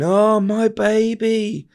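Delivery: very high, slow wailing mock scream; high, drawn-out scream without full volume; retold mother's scream. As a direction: stretch it into a grieving mum's wail. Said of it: "And she's screaming".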